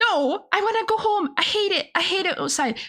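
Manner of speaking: high-pitched "I wanna go home" whine; whiny protest. Acted. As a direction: complain like a fed-up kid. Whining to go home.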